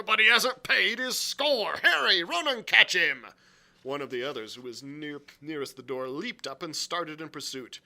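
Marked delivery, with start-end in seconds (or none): 0.0-3.3 loud, high shouted order; crying out. A narrator's reading. Silver sends Harry after the runaway, crying out. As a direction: bellow it across the tavern. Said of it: "cried Silver".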